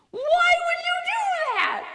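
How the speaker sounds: high, slow, disbelieving outcry; incredulous. Retold or imitated. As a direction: ask it in total disbelief. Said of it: "And I was like".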